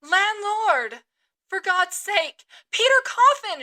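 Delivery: high, loud cry for help; panicked shout. Acted. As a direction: scream for the landlord in terror. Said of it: "shouted I".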